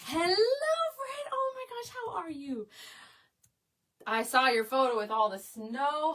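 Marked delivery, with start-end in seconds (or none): 0.0-2.7 thrilled, sing-out greeting to a friend; excited greeting. Real, not acted. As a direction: squeal a delighted welcome. Thanking and greeting arriving viewers.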